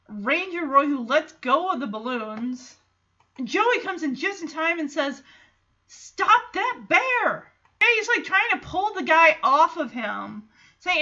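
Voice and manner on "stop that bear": high, drawn-out cry of alarm; mock yell, stretched out and raised in pitch; acted urgent shout. Joey rushing in to stop the bear, mock urgent shout.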